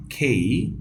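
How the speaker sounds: "খেই" with aspirated kh and glide; teacher's drill of "khay-ee"; model pronunciation. Shown as a demonstration. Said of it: "আবার বলছি".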